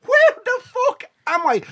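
high, slow, panicked cry; panicked shout. Retold or imitated. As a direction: yell it in sudden panic. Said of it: "I was going".

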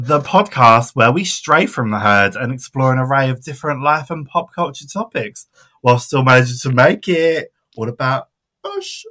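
usual speaking voice

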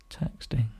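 breathy whisper. Real, not acted. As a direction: whisper the last word. Mocking the friend who is texting.

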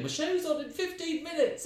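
high, panicked plea outside the venue; desperate cry. Retold or imitated. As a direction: yell it like time's running out. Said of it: "desperately going".

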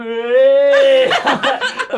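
loud, high drawn-out squeal; mock hauling cry. Teammate pretends to haul the assistant out, high mock cry. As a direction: let out one long, high playful yell.

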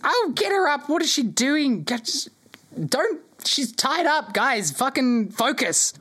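put-on high, exasperated old elf woman; elderly elven lady. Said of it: "This soft voice and gentle tinkling".

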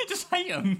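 high squeal of laughter into words; amused, laughing disbelief. Real, not acted. Laughs at blunt answer.

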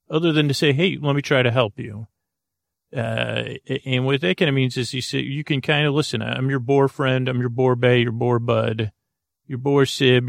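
ordinary talk

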